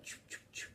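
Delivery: quiet puffed "choo" sounds; imitated tea-making noise. Imitating Abdul making green tea, soft sound effects. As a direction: mime the action with little mouth noises. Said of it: "He's like".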